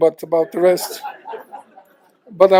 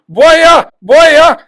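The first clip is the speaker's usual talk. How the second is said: loud high shout of warning; retold scream; 10 semitones higher, 10 dB louder than usual. Guy in boat screams warning at snorkeler, loud shout. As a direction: yell the warning across the water. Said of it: "he was screaming at me".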